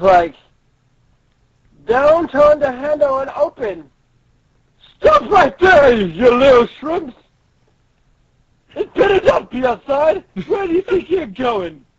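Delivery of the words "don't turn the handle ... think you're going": high, commanding stop order; manager's shouted warning. Acted. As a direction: holler at escaping little shrimps. Acted manager stopping runaways in story.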